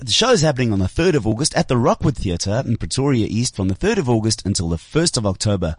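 promo voiceover, sinking low at the end; announcer voice dropping to a low sign-off. Pretend. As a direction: read it like a radio advert. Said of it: "That's his voiceover voice".

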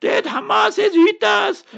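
high-pitched mocking wail, shouting the last part; mocking imitation of a crybaby victim; mock crybaby whine. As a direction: whine it like a crying child to ridicule them. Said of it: "then they will cry like small babies".

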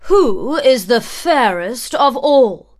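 loud, demanding queen; vain queen. A narrator's reading. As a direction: demand an answer from the mirror. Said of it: "the queen asked her mirror".

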